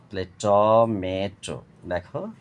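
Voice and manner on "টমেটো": টমেটো drawn out as ট, মে, টো; split in three syllables. Shown as a demonstration. Teacher repeating for pupils, টমেটো in three slow parts. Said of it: "তিনবারে ভেঙে উচ্চারণ করা হয়".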